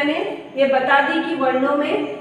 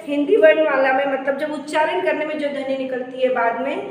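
ordinary talk

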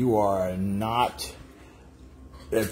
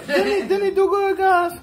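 excited laughing; 6 dB louder than usual. Real, not acted. Cheering him on to viewers.

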